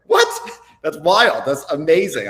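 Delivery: loud, high-pitched mock disbelief; hammed-up thrilled outburst; amazed reaction. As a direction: burst out astonished at big news. Acts out stunned reaction to news.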